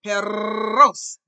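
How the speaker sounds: "Perros" with a long, very loud trilled rr; exaggerated rolled R. Shown as a demonstration. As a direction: hold the tongue roll in "Perros" and belt it. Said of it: "you roll it".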